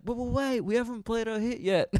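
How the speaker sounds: high-pitched band member protest; mock desperate pleading. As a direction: plead with a departing crowd in a put-on high voice. Band pleading as crowd leaves.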